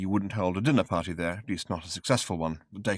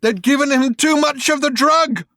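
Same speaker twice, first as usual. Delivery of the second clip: high, loud desperate shout; frantic shouted warning; 10 dB louder than usual. Acted. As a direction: yell it at them from the sickbed. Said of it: "shouted at them".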